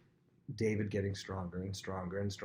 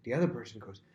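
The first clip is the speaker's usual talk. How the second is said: normal talking voice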